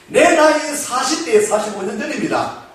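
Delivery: ordinary talk, nothing marked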